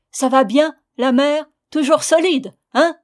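loud, high-pitched hearty call; shouting into a deaf ear. A narrator's reading. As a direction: shout it so a hard-of-hearing old woman hears. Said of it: "en criant dans son oreille".

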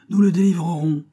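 high, loud rallying shout; roaring crowd. A narrator's reading. Crowd vows to free the boy's father, roaring loudly. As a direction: roar the promise as a crowd. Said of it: "mugit la foule".

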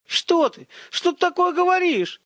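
very high, loud squawking protest; high-pitched panicky fussing; clucking fluster. A narrator's reading. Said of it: "закудахтал Владимир Петрович".

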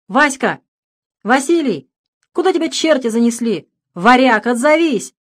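громко зовёт, голос тянется вверх; кричи сверху в яму, ищешь друга; зов издалека. Acted. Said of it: "Очнулся он от крика". (громкий зов сверху: «Васька! Василий!»)

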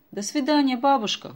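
high, loud call while running; boy calling goodbye while running. A narrator's reading. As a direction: shout goodbye over the shoulder. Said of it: "уже на бегу крикнул Стас".

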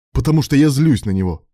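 furious admission; barking. A narrator's reading. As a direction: snap the words at him. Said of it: "рявкнул Илья".

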